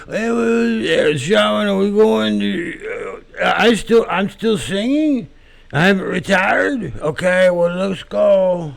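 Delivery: high, slow, spaced-out mumbling [name]; dazed [name] impression. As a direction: play a confused, fried rock star being led on stage. Dazed [name] asking Sharon.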